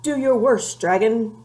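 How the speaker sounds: a knight shouting defiance at a dragon; bold declaration. A narrator's reading. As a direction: throw down the challenge. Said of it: "declared the shiny man".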